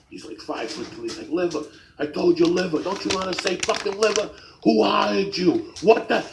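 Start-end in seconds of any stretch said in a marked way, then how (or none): 1.3-6.2 high, loud rant of an outraged customer; mock rage. Old man berating the kid making his sandwich, raging. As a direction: act out an old man blowing up. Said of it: "in the middle of his rage".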